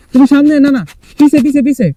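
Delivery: shouting to a teammate to step back; shouted directions. Real, not acted.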